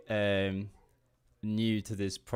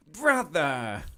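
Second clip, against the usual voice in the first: loud, higher character cry; put-on villain voice, pitched up and loud; Liquid Snake; 7 semitones higher, 7 dB louder than usual. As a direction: call it out like a game villain. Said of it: "Liquid Snake".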